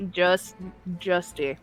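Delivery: ordinary delivery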